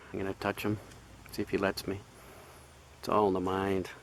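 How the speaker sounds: ordinary delivery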